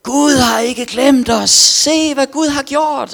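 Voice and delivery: high, loud, slow proclaiming shout; slow, high, loud preacher's cry to the crowd. Retold or imitated. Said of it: "viftede dem op".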